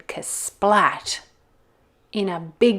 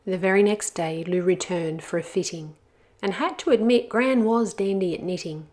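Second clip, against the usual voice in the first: usual speaking voice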